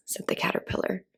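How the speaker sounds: usual speaking voice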